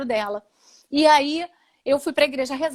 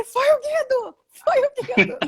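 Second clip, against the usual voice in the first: joyful shout; 11 semitones higher than usual. Retold or imitated. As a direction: shout the news out in delight. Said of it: "eu dei um grito".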